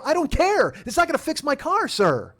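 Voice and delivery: high, exasperated protest. Retold or imitated. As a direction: push back in high indignation. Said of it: "come over here and raise your voice".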